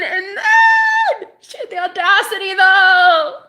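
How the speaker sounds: high, loud, drawn-out shout; outraged shriek. Real, not acted. Outraged at her audacity, high drawn-out shout. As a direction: wail it slowly at full volume.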